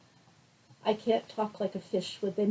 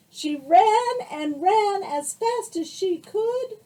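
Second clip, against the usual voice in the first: high, loud, slow building suspense; dramatic read-aloud narration; excited storytelling; 9 semitones higher, 10 dB louder, slower than usual. A narrator's reading. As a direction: read the chase with rising thrill. Excited climax reading.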